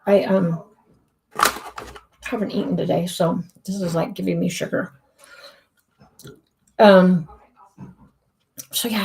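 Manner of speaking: talking while chewing gum. Real, not acted. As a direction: speak around a piece of gum. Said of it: "Sorry, I'm chewing gum".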